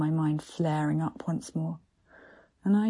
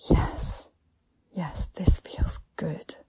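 slow aroused whisper; breathy whisper; slower than usual. A narrator's reading. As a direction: savour each word in a whisper. Pleasure in erotic scene.

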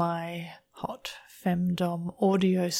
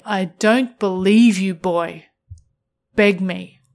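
commanding dominatrix; 7 dB louder than usual. Acted. Dominant partner demands begging.

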